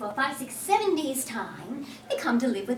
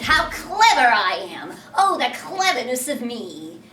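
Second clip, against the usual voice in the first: loud, cocky boast; boastful; 8 dB louder than usual. Acted. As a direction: crow about your own cleverness. Boastful Peter crowing.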